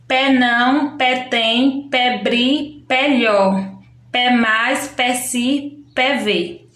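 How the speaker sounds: "pé" placed before each syllable, one syllable at a time; slow, playful children's-game reading; língua do P demo. Shown as a demonstration. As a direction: chop the sentence into syllables, each led by a clear "pé". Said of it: "ler em voz alta na língua do P".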